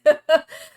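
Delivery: loud high laugh bursting out; burst of laughter. Real, not acted. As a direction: crack up loudly. Laughs at her own story.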